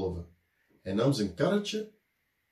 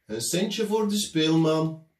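street musician calling; 5 semitones higher, 5 dB louder than usual. A narrator's reading. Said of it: "riep hij telkens".

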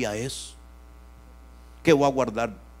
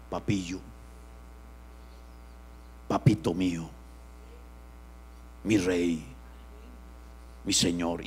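lower, soft loving address; tender devotion; 7 semitones lower, 6 dB quieter than usual. Acted. Preacher showing how he calls Jesus, lower and tender. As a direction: whisper love to your Lord. Tender pet names for God.

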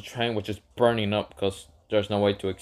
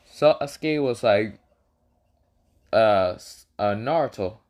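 plain reading voice

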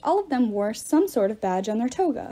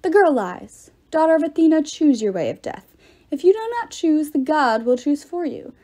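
angry threat; 5 semitones higher, 4 dB louder than usual. A narrator's reading. Pater threatens Annabeth.

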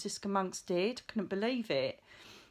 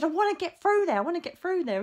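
higher, louder insistent demand; rude demand; 9 semitones higher, 5 dB louder, faster than usual. Retold or imitated. Retelling how she demanded the nun open the archway, loud and insistent. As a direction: push at her, pitched up and loud. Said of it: "really rude with her".

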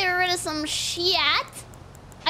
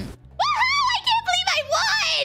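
pretend victory cheer. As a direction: cheer like you just won. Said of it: "To everybody pretend I won".

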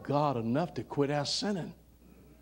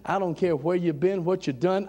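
ordinary talk, nothing marked

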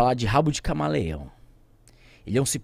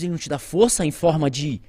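ordinary talk

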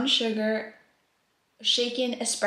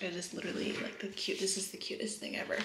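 soft, touched murmur; 11 dB quieter, faster than usual. Real, not acted. Thanking friends for the gifts.

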